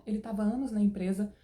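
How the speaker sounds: ordinary delivery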